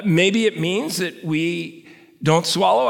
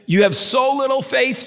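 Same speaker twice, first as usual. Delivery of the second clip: rebuke; 7 semitones higher than usual. A narrator's reading. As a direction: call out the lack of faith sharply. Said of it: "Jesus said".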